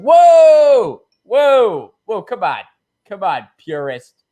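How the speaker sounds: high, loud shout that drops to a sneer; hammed-up scolding yell fading to a jeer; mock shouted whoa. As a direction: shout down the purist, then scoff. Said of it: "It's just like".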